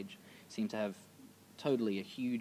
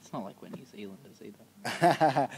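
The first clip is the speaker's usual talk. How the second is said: laughing; 7 dB louder than usual. Real, not acted. Teasing joke about New Zealanders.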